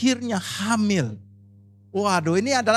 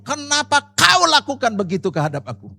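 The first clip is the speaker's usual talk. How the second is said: angry at God; 5 semitones higher, 8 dB louder than usual. Retold or imitated. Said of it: "Dia ngomong".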